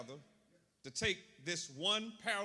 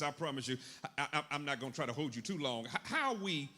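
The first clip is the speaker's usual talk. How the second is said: normal talking voice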